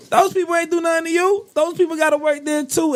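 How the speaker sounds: indignant high-pitched scolding; heated rant. Real, not acted. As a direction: pitch jumps high in outrage. Ranting at the hotel pisser.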